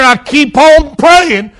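shouted cry of the abandoned; pleading anguished Jesus. Acted. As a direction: plead harder with every line. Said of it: "he says here".

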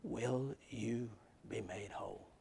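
slow, very soft and intimate; sudden hushed voice. Acted. Jesus speaking to a numb heart, soft and slow. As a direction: breathe it softly, like a voice inside. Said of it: "and then all of a sudden".

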